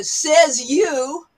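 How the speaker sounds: punchy doubting "Says you."; cocky dismissive comeback; skeptical retort. Shown as a demonstration. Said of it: "In another region, we might say".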